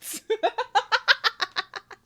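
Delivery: a high, loud burst of cackling; loud cackling laugh. Real, not acted. Cackling at the memory of the queens' reaction. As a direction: crack up at the memory of the queens' faces. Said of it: "I died".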